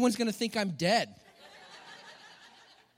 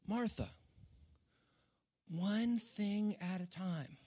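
much softer and slow, tender; slow right down and soothe her; gentle Jesus; 11 dB quieter, slower than usual. Retold or imitated. Jesus calming busy Martha, soft and slow. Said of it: "Jesus in this moment was saying".

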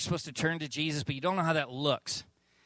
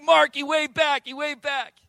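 excited; 9 semitones higher, 5 dB louder than usual. Retold or imitated. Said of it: "I'm like".

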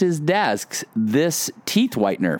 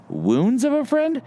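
incredulous; 11 semitones higher than usual. Retold or imitated. Mock shock at verse.

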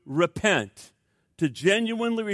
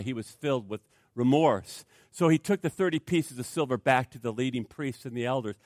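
usual speaking voice

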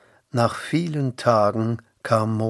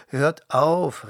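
raised, higher narrated shout; calling out; 5 semitones higher, 6 dB louder than usual. A narrator's reading. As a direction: call out sharply to stop two men fighting. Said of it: "rief Mose".

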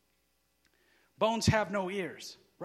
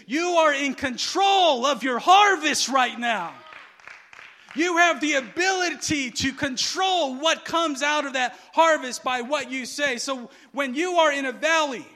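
raised, emphatic preaching; 4 semitones higher, 8 dB louder than usual. Real, not acted. Preacher telling the congregation they control their harvest, emphatic preaching. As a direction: drive it home like a preacher.

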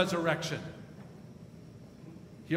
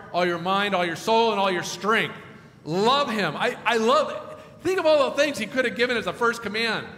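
loud, driving sermon voice; full-force preaching on the greatest commandment; 4 dB louder than usual. Acted. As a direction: preach it out to the whole room.

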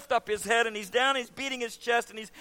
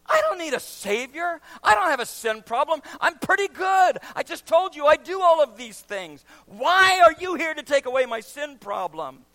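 loud, higher offended protest; indignant young ruler; 4 semitones higher, 5 dB louder than usual. Acted. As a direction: scoff at needing a savior. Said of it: "the young man would have looked at him and said".

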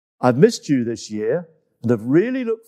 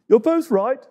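higher, cheerful giraffe voice; 9 semitones higher than usual. Acted. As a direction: pitch it up, friendly. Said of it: "said Twigger".

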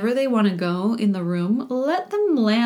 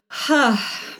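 audible sigh; 3 semitones higher than usual. Shown as a demonstration. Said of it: "make some sound if you wanna make some sound".

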